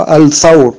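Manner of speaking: Al-thawr with no l, long th; doubled th. Shown as a demonstration. Teacher correcting the mistake, "Al-thawr" said with doubled th. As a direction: merge the l of "Al-thawr" into the th. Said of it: "On ne dit pas".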